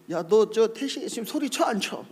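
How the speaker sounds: high, insistent question; acted incredulous questioning; pastor demanding confirmation. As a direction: appeal to a bystander as witness, imitating the pastor. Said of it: "우리 집사람 보고".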